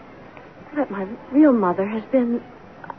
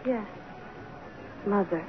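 timid submission; 15 dB quieter than usual. Acted. Girl submits to stepmother.

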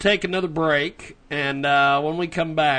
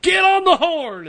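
catchphrase impression; 14 semitones higher, 6 dB louder than usual. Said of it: "as he used to say".

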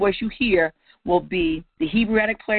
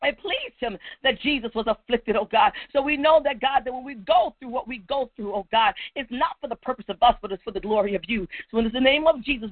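higher-pitched, urgent prayer cadence; impassioned prayer; 6 semitones higher than usual. Real, not acted. Preacher surrendering to God before the congregation, impassioned prayer. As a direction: cry out to God in swelling phrases.

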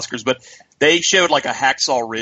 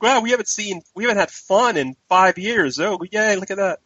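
excited women; 6 semitones higher than usual. Retold or imitated. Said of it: "It's like".

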